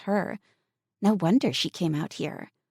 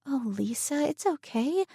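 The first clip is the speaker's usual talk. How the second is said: gentle, higher comforting voice; compassionate; 11 semitones higher than usual. A narrator's reading. Emily comforting Lisa with breakfast, compassionately. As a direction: soothe a frightened girl. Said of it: "she said compassionately".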